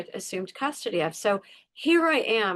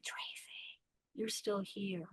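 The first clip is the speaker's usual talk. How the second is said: soft whisper, much softer; gentle inner whisper; 15 dB quieter, slower than usual. Retold or imitated. Her heart whispering to her that she survived. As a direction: breathe it out tenderly. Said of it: "my heart's going".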